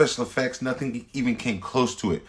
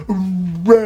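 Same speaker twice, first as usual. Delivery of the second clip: loud high mock yell; hammed-up Finn shout; Finn yelling Rey; 11 semitones higher, 9 dB louder than usual. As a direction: yell her name across the battlefield. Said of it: "And he was like".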